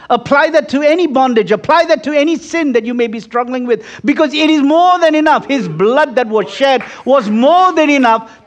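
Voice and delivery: high, loud, rousing preaching; full-force preaching. Acted. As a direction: lift the congregation, hammering each line.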